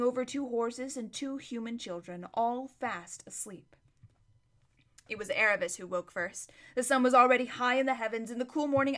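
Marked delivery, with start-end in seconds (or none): none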